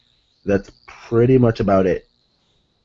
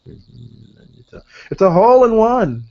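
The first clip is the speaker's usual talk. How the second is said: golf commentator; 8 semitones higher, 6 dB louder, slower than usual. Retold or imitated. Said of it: "That's how Cena sounded last time".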